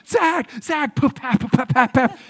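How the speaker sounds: high, urgent mock shouts and flash pops; clamor for the star's attention; imitating photographers. Photographers as the actor walks out, mock shouting. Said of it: "everyone's like".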